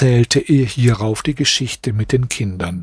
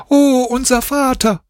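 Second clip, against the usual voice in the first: put-on high child voice calling for father; children crying out; 12 semitones higher than usual. A narrator's reading. Children calling out to their father, high child voices. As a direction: cry out like small children spotting their dad. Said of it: "Da schrien die Kinder".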